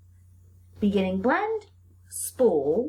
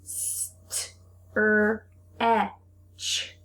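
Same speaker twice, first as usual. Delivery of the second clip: sounding out; much slower than usual. Shown as a demonstration. Teacher segmenting a word for the class. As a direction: split into separate sounds. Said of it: "how many sounds are in this word".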